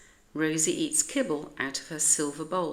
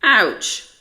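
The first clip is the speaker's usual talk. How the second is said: much louder yelp of pain; 5 semitones higher, 12 dB louder than usual. A narrator's reading. Maurice gets bitten by Rosie and yelps. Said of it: "says Maurice".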